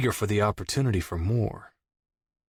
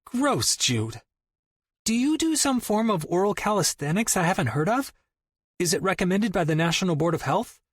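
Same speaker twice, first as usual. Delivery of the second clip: put-on higher, quick child voice; little girl voice; 8 semitones higher than usual. A narrator's reading. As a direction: play the niece, bright and quick.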